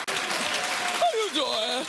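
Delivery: very slow how you doing with a rising end; slow drawn-out greeting. Shown as a demonstration. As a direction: drag every word, lift at the end. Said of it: "catchphrase drawn out, rising".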